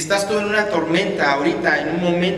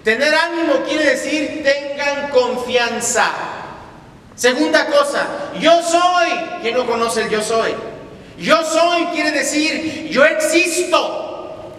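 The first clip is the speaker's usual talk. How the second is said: higher, louder, slow emphatic preaching; preaching at full force; 9 semitones higher, 3 dB louder, slower than usual. Real, not acted. Preacher proclaiming to the church that Jesus calms storms. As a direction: drive the promise home to the congregation.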